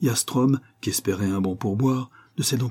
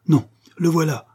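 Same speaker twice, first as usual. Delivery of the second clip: higher, louder cry of discovery; exclaimed; 7 semitones higher, 5 dB louder than usual. A narrator's reading. Steward spotting the knife on the floor, exclaimed. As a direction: call it out on spotting it. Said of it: "s'écria le maître d'hôtel".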